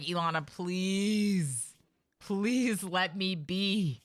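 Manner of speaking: slow, drawn-out begging voice; weary colonist. Retold or imitated. Streamer voicing tired colonists begging her, slow weary plea. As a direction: plead to be left alone, exhausted. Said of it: "I imagine they're just like".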